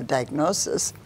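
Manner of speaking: usual speaking voice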